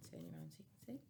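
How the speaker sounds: breathy unvoiced whisper aside. Real, not acted. Reader whispering an aside to her listeners. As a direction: quickly whisper it to the viewer.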